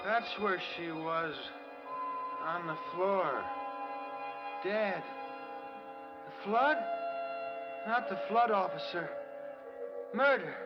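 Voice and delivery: high, halting fragments with long gaps; delirious. Acted. Shocked patient reliving a murder and flood, delirious. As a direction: rave as if trapped in a nightmare.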